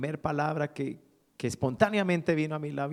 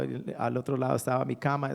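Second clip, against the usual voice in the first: ordinary talk, nothing marked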